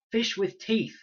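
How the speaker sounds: high, loud blunt answer; Fred yelling the danger; urgent shout. A narrator's reading.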